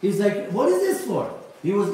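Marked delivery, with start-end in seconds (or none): 0.5-1.3 frustrated outburst, pitched high; retelling a man's angry complaint; exasperated demand, raised and pushed. Retold or imitated. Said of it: "He came to me all ang-, angry, angry".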